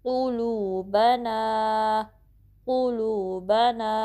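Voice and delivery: slow क़ुलूबना with long लू and ना; measured, drawn-out क़ुलूबना; two-count stretches. Shown as a demonstration. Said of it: "लू को to count stretch करना है".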